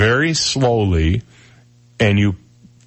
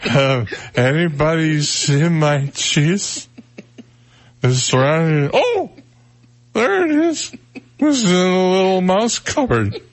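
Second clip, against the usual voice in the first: put-on slurred, slow, drunken mouse; 5 semitones higher than usual. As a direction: play a tipsy little mouse looking for cheese. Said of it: "Do the drunk mouse".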